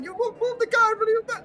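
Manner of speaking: very high bickering gnome; squeaky gnome traffic argument; yelling gnome. Acted. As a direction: yell back in a high comic gnome voice. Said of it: "These gnomes are all yelling at each other".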